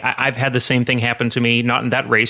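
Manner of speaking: normal talking voice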